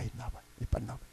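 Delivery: fast, very soft call-out; hurried mutter. Acted. Preacher quietly hurrying a woman to come forward. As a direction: rush the words under your breath.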